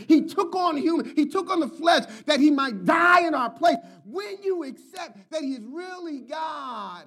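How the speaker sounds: preacher driving home that Jesus is God; preaching. Acted. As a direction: rise to a pulpit climax. Preaching climax.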